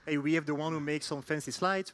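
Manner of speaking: normal talking voice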